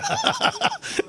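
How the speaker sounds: real falsetto giggle; high squealing laugh. Real, not acted. Comedian laughing at his own dog joke, high squeal.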